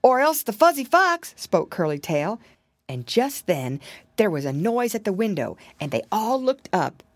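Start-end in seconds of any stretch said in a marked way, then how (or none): 0.0-1.3 high, louder piglet voice; little piglet voice. A narrator's reading. Piglet guessing who is outside, high little voice. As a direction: pipe it up high and loud.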